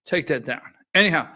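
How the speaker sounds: ordinary talk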